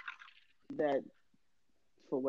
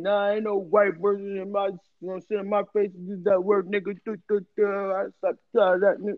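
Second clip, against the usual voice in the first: higher-pitched mock grumbling rant; mock protesting men; 3 semitones higher than usual. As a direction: imitate protesters, dissolving into mocking duh-duh filler.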